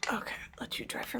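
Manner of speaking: soft whispered aside. Real, not acted. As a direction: breathe it out in a whisper. Whispering to drying nails.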